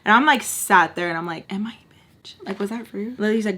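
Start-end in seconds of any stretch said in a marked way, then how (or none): 1.5-3.2 soft, half-whispered worried question; hushed self-doubt. Retold or imitated. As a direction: quietly check with a friend. Retold anxious self-doubt to friend.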